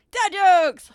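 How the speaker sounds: loud, high showy shout; big segment announcement. Pretend. Host introduces favorite channel segment, loud fanfare. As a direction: announce it like a game-show title.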